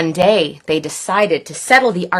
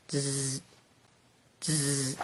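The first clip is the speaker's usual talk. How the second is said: 1 electric buzz; 8 semitones lower, 19 dB quieter than usual. Retold or imitated. Lightning buzz imitated.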